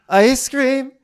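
high, loud gleeful squeal; hammed-up thrilled imitation; mock excitement. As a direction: burst out like a kid promised a treat. Voicing woman bribed with ice cream.

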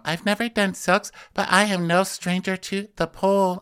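a hint of [name] showing off; mock [name]. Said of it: "And she's like".

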